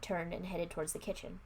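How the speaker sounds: ordinary delivery, nothing marked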